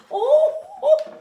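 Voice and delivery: very high, loud oh-oh of surprise; loud high-pitched acted squeal; startled cry. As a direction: yelp as the chair gives way. Chair breaks under her.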